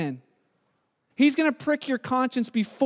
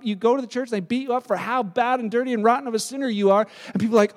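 ordinary talk, nothing marked

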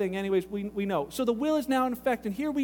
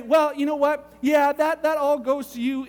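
louder, higher mock executor voice; executor improvising; 4 semitones higher, 6 dB louder than usual. Brother-in-law tampering with the will, loud improvised bargaining. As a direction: butt in loudly with a made-up condition.

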